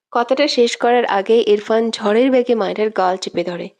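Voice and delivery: ordinary delivery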